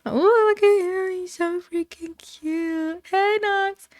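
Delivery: high, drawn-out cooing; adoring gushing. Real, not acted. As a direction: coo over someone adorable. Gushing over a cute character.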